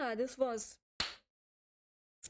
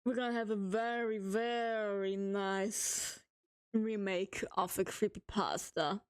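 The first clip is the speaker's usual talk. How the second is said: "very, very nice" stretched out very slowly; mock serious drawl. Shown as a demonstration. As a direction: drag out "very nice" for solemn effect. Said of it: "now we're gonna go serious mode".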